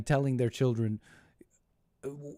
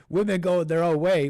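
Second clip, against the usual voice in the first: louder, higher sarcastic chant; loud mock rally cry; mocking slogan; 6 semitones higher, 7 dB louder than usual. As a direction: sneer out the slogan like a joke.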